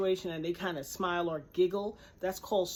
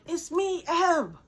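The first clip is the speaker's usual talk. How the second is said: high, loud, overjoyed re-enactment; imitated excited reveal; 10 semitones higher, 7 dB louder, slower than usual. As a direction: insist joyfully on being recognized, voice raised. Woman joyfully reveals who she is.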